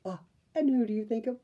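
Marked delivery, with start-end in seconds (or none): none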